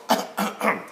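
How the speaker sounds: put-on throat-clearing cough; demonstrative cough. Shown as a demonstration. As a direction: cough to illustrate the word. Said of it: "that's cough".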